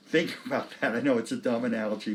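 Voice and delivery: ordinary talk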